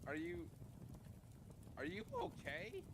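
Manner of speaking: usual speaking voice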